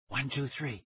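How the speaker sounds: soft, hushed count run together; whispered in one breath. A narrator's reading. Tara counting friends down to run, whispering in one breath. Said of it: "Tara whispered in one breath".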